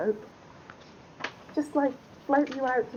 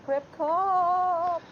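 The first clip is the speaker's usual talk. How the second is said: high, loud summoning call; summoning shout; 8 semitones higher, 9 dB louder, much slower than usual. Pretend. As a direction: bellow to summon your steed. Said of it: "I summon my steed".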